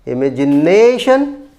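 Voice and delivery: imagination said slowly, NA long and rising; slow down and punch NA in imagination; stress on fourth syllable. Shown as a demonstration. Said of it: "Stress on the fourth syllable". (imagination के चौथे syllable na पर ज़ोर)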